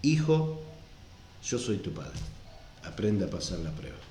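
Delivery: deep, quiet divine father voice. Retold or imitated. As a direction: murmur it low like God himself. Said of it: "una palabra que nos va a decir".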